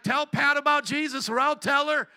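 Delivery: emphatic retold ultimatum; insistent demand. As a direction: push it hard, like nagging a reluctant parent. Retells pushing his mom to comply.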